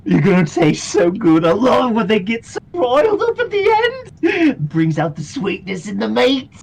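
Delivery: high, loud villain relishing a meal; put-on villain voice, loud and gleeful; gloating villain voice. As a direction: gloat over your prey in a high, loud voice. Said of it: "Merc just goes".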